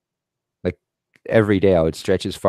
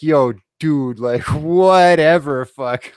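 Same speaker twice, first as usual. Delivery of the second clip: high, louder, drawn-out scoff with a laugh; retold laughing brush-off of a braggart; incredulous dismissal; 6 semitones higher, 4 dB louder, much slower than usual. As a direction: stretch WHATEVER out in laughing disbelief. Retelling scornful reply to bragging boss.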